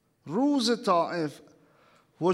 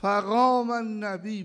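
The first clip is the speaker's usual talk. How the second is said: ordinary delivery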